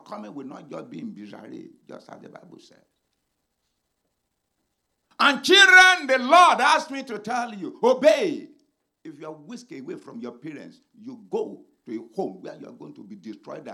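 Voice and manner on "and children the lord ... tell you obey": high, loud preacher shout; shouted command. Real, not acted. Preacher calls out to children.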